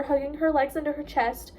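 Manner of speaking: ordinary delivery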